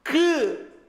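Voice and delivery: usual speaking voice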